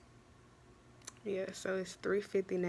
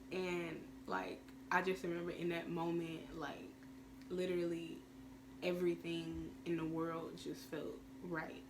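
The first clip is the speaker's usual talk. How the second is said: ordinary talk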